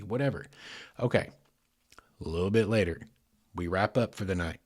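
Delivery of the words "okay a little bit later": ordinary delivery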